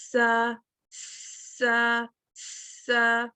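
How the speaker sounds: سا with a thin hissed s and a light vowel. Shown as a demonstration. Teacher showing the light سین syllable: thin hiss. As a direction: keep سا light and whistly. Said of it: "جبکہ سین ہے تو".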